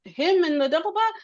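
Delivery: high, bright-eyed preschool host voice, lightly mocked; sing-song greeting to a cartoon friend; kids' show host. Recalling the show recap to the interviewer, in a high host voice.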